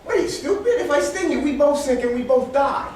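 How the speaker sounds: high-pitched, exasperated scorpion; scorpion arguing. Acted. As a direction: reason insistently, as if the frog is being silly. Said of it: "Scorpion says".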